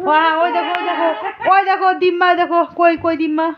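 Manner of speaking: high bright pointing out; excited. Real, not acted. Pointing out grandma to baby.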